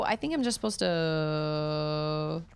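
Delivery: ordinary delivery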